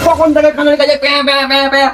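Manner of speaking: mocking the nagging. Husband mocks wife's nagging.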